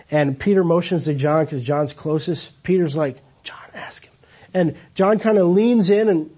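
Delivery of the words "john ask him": hushed aside to a friend; whisper. Retold or imitated. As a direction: breathe it so Jesus can't hear. Peter whispering to John at table.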